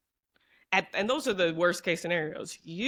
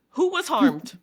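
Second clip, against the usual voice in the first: louder, higher demand; emphatic question; 8 semitones higher, 7 dB louder than usual. Real, not acted. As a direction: ask it loud and pointed. Pointed rhetorical question.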